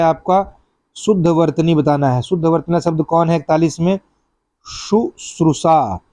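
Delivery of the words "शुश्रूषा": शुश्रूषा spoken very slowly, each syllable clear; correct spelling said out. Shown as a demonstration. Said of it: "यही शुद्ध है". (शुश्रूषा धीरे-धीरे, हर अक्षर साफ़)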